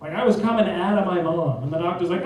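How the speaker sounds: ordinary talk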